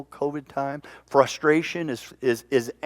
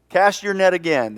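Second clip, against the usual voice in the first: calling out; 6 semitones higher, 5 dB louder than usual. Acted. Man on shore calls to fishermen.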